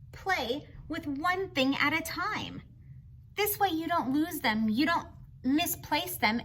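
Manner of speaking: usual speaking voice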